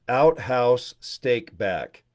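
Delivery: very slow, drawn-out "Outhouse Steakback"; slow repeat-after-me. Shown as a demonstration. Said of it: "Repeat after me".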